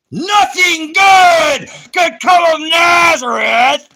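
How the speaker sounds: huge, slow, drawn-out bellow; booming mock proclamation. Mocking David's Nazareth claim, a slow booming bellow. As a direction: roar it out like a mock prophet, slow and high.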